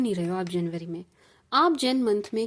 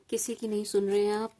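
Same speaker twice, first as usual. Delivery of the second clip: ordinary delivery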